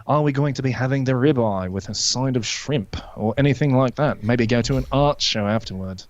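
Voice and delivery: refined, posh British waiter; well-to-do British accent. Shown as a demonstration. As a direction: take the order in posh British. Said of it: "there's the more posh one".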